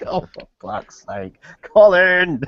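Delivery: slow groan, then loud shout of Colin; fed up, building to a yell; exasperated. Retold or imitated. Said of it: "they're like".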